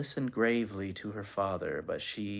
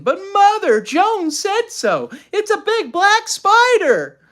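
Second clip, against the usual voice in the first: put-on high, loud squealing child; 11 dB louder than usual. A narrator's reading. Anne jumping by her mother, squealing in panic. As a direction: squeal it in protest, pitched way up.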